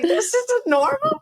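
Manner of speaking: high, slow laughing this isn't normal; laughing at a yawning date; laughing disbelief. Retold or imitated. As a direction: crack up in disbelief. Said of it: "I was like".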